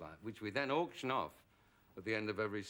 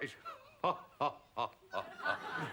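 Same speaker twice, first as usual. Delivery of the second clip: loud, higher laugh of delight; real laughter; 5 semitones higher, 4 dB louder than usual. Real, not acted. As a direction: burst out laughing at the memory. Laughing at the memory.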